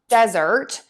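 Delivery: "desert" with a strong first syllable, DEZ-ert; stress on first syllable. Shown as a demonstration. Teacher shows the sandy area word, first syllable of "desert" stressed. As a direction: punch the start of "desert". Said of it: "We're going to stress syllable one".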